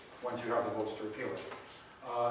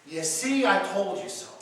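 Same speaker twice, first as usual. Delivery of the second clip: higher and louder mock gloat; gloating I told you so; 5 semitones higher, 6 dB louder than usual. Shoving "told you so" at a former liberal, loud mock gloat. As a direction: crow it out like a schoolyard taunt. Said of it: "one thing you never do is".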